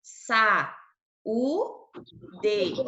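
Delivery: "sa, u, de" split by clear pauses; syllable by syllable. Shown as a demonstration. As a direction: count out "sa, u, de" one syllable at a time. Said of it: "as vogais estão em sílabas diferentes". ("sa, u, de" separando cada sílaba com pausa)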